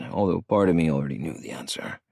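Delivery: ordinary delivery, nothing marked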